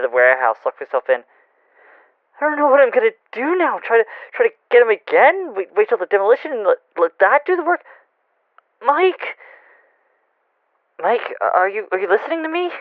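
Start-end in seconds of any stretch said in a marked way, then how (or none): 2.4-7.8 higher-pitched, agitated rush of questions; frantic and at a loss, voice climbing; panicked. Acted. Fritz at a loss over the phone to Mike, panicked. 8.9-12.7 anxious. Acted. Caller gets no answer.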